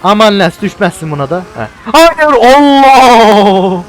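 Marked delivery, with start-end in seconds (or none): 1.9-3.8 very slow, high, loud shout; drawn-out alarmed cry. Real, not acted. Gamer cries out as the motorbike nearly crashes. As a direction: stretch the cry out in shock.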